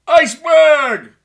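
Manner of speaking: a hammed-up lookout yelling a warning; mock lookout shout. Titanic lookout calls iceberg.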